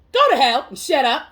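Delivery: loud, punchy dismissal; scornful retort. Acted. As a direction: snap back at the slogan. Scornful reply to the soft slogans.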